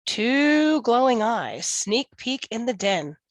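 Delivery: ordinary delivery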